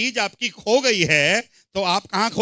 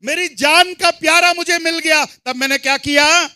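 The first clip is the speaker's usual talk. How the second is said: forceful preaching; 7 semitones higher, 7 dB louder than usual. Real, not acted. Preacher echoes the verse to the congregation, forceful preaching. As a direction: proclaim each phrase to the whole hall.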